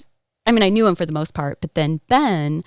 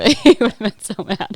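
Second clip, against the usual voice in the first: amused laughing; 3 semitones higher, 8 dB louder than usual. Real, not acted. Guest imagining the housemate's anger, laughing. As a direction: laugh into the words, a bit louder.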